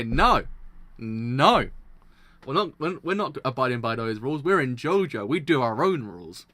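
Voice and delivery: loud, defiant mock declaration; defiant shout. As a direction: declare it like a rebel. Mock defiant quote of the characters.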